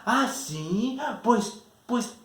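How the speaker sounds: put-on high, loud childish jab; taunting insult. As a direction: fling the insult like a playground taunt. Kids trading insults in a quarrel. (burla infantil, aguda y fuerte)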